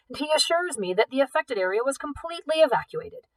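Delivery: high, smug assurance; pompous official. A narrator's reading. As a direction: assure him smugly. Director continues.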